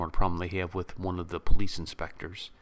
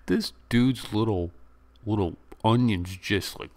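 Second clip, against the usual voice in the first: ordinary talk, nothing marked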